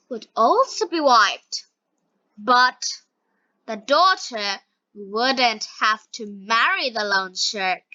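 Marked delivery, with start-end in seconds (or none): none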